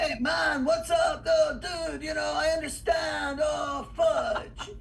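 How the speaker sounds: put-on high, sing-out Spanish-accented stoner; Cheech. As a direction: holler it in a Chicano dude accent. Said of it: "I used to do Cheech".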